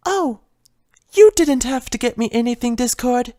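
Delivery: a timid pony fretting over a broken vase; Fluttershy's voice. Acted.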